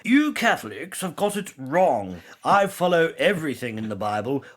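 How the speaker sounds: a put-on British accent for the opponent; strong British accent. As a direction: play a smug Brit who is sure he is right. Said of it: "put on a strong British accent".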